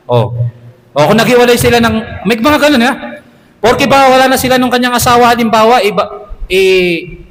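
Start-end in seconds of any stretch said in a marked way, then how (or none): none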